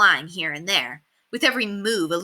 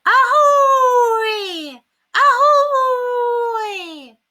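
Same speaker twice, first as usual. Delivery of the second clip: very high, loud howling squawk; wolfish screech; 7 dB louder, much slower than usual. A narrator's reading. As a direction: screech it like a parrot turning wolf. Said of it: "bizarre screeches ... getting louder and wolfier".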